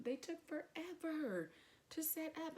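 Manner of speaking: high, slow, drawn-out grumbling; exasperated complaint. Retold or imitated. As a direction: stretch it out in mock impatience. Complaining about a long wait.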